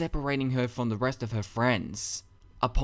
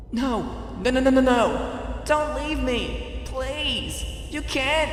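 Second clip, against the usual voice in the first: high, loud frantic begging; high desperate cries, slowing on don't leave me; desperate pleading; 13 semitones higher, 3 dB louder, slower than usual. A narrator's reading. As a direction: plead as friends walk away, panicking. Twilight begging friends not leave.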